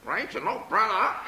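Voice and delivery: high squawky parrot voice. Acted. Parrot coming down off his perch, high squawk. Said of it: "Peter the parrot said".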